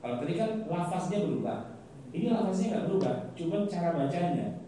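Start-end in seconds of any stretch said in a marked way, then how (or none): none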